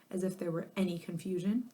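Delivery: ordinary delivery, nothing marked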